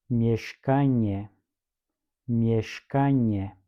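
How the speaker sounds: each word said carefully, twice, with pauses to repeat; careful teacher read of a vocabulary list; model pronunciation drill. Shown as a demonstration. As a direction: say every word cleanly twice for the class to echo. Teacher models words for repetition.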